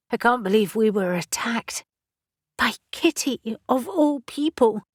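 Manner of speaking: hushed whispered disbelief. Acted. Shaken landlady to Caspian, stunned whisper.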